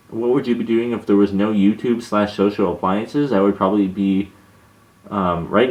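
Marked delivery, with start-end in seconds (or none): none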